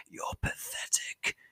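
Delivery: slow contemptuous whisper; slow scornful whisper; whispered contempt. Acted. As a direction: breathe the insult in a whisper. Mother's final insult.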